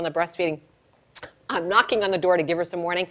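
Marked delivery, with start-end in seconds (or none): none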